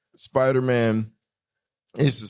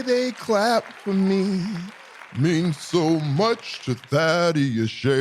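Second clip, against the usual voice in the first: slow, drawn-out showman voice, a lightly put-on vanity; milk the applause like a self-loving host, slowly; hammed-up host voice; 2 semitones higher, slower than usual. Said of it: "I don't even know why I'm talking like that the whole time".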